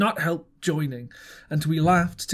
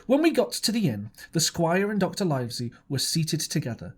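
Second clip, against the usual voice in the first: usual speaking voice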